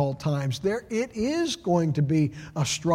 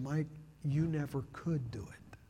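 gentle whisper; 8 dB quieter than usual. Retold or imitated. Holy Spirit speaks to him softly.

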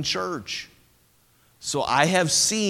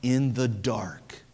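solemn quote; 6 semitones lower, 7 dB quieter, slower than usual. Retold or imitated. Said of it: "D L Moody said this".

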